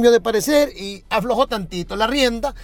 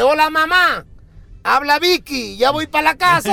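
cheeky daughter as Vicky; 5 semitones higher than usual. Acted. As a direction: toss it off cheerfully, the punchline. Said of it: "se reporta la hija de nuevo y le dice".

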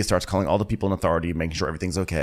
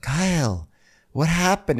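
raised, drawn-out questioning voice; mock-concerned boss, pitched up; Sandy; 8 semitones higher than usual. Sandy coming down to question Kyle, high mock concern. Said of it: "when Sandy came down".

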